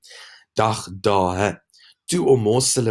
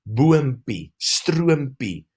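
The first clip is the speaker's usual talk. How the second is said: ordinary delivery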